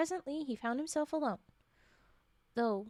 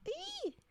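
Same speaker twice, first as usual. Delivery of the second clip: excited squeal; 4 dB quieter than usual. Real, not acted.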